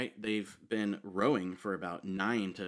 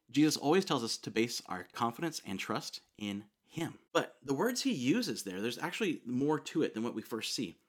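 normal talking voice

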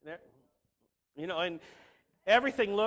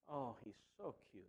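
a soft, much lower, much quieter mock coo; 10 semitones lower, 18 dB quieter than usual. Preacher mocks the idea of God swooning, mock coo. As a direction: coo it like a smitten teen. Mocking God cooing over creatures.

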